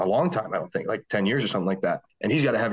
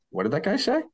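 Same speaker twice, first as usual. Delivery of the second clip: higher, puzzled quote; mock bewildered question, pitched up; retold bafflement; 9 semitones higher than usual. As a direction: act out a confused double take. Retold confusion at hearing pasta.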